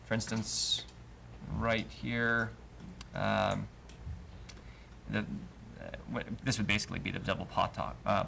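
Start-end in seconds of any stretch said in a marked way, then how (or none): none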